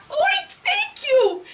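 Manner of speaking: very high, slow piggy squeal; grateful pig. Acted. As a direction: oink out your thanks. Pig's grateful reply.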